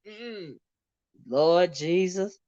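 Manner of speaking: ordinary talk, nothing marked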